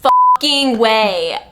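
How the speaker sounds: loud, slow disbelieving shout; mock outrage. Billy spotting a vampire, loud mock shout. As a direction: yell it in fake shock. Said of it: "And then it's like".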